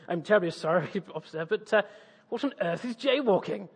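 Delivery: hammed-up British apology; exaggerated British accent. Tourist questioning an American policeman, exaggerated British accent. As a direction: play the bumbling, polite Brit. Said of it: "I'll just crank up the Britishness".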